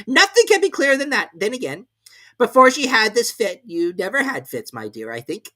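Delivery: high, loud smug king voice; pleased with himself, then sly to his wife. A narrator's reading. The King to the Queen, high and smug. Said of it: "he said to the queen".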